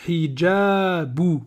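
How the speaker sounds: hijabu linked very slowly; slow liaison. Shown as a demonstration. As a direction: glide slowly through hijabu. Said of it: "Si je fais la liaison".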